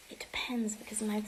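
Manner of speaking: whispered aside; hushed, breathy. Real, not acted. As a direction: drop to a whisper.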